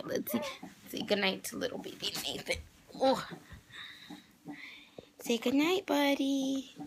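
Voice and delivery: put-on high soft baby talk. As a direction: coo it to the baby. Talking to the baby at bedtime.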